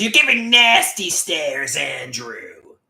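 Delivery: high, loud, slow mocking accusation; mocking mother. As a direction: drag it out like his scolding mom. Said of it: "She says".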